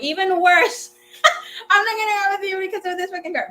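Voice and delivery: high, fast, sassy mock outburst through laughter; hammed-up teenage brat refusing the boy with a car; bratty refusal. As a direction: fire it back like a spoiled teen, laughing. Retelling her bratty refusal.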